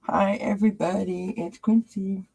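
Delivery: high, playful pet-introduction voice; speaking for pet. Pretend. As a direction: greet viewers high-pitched, drawing out the dog's name.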